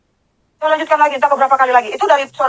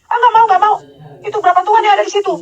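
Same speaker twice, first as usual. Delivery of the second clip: higher-pitched, emphatic refusal; raised, insistent retort; loud inner protest; 8 semitones higher than usual. Retold or imitated. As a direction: act out refusing an unseen voice. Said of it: "saya bicara tuh nggak keras begini".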